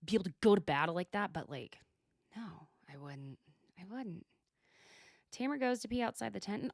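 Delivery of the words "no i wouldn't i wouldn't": whisper. Real, not acted. Admits she wouldn't survive.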